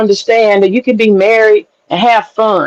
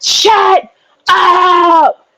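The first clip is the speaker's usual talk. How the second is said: high, drawn-out mock scream; high-pitched imitation of someone yelling; imitated scream; 9 semitones higher than usual. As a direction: mimic him screaming, stretching each word high. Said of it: "He started screaming one day".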